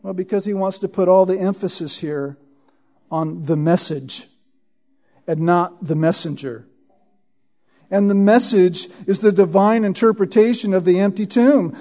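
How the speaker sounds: ordinary talk, nothing marked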